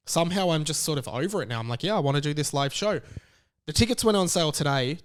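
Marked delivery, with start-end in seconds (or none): none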